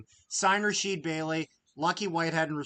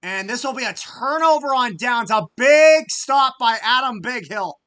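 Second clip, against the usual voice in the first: much higher and louder, calling the play as it happens; excited play call; 9 semitones higher, 12 dB louder than usual. Real, not acted. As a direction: shout it out as the defense makes the stop. Fan calling a big defensive stop.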